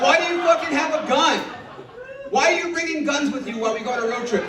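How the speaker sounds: high-pitched exasperated shouting; outraged yelling. Acted. Comic berating girlfriend over the gun under the seat, high outraged yell.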